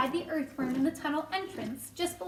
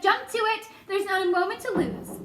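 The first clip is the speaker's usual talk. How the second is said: urgent, higher-pitched call; rally the crew with raised voice; shouted command; 5 semitones higher, 3 dB louder than usual. A narrator's reading. James hurrying his crew into action, urgent shout. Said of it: "James shouted".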